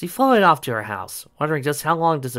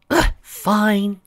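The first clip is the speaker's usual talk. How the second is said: high, fed-up sigh; exasperated; 10 semitones higher, 5 dB louder than usual. Acted. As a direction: give in grudgingly. Sally giving in.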